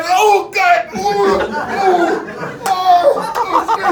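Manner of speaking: loud, high hammed-up wailing; mock cries of pain. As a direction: flip into exaggerated howls after being hit. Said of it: "and he went from".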